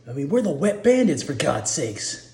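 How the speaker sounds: joking Marv. Acted. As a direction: play Marv's joke with a lift. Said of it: "the Marv is joking".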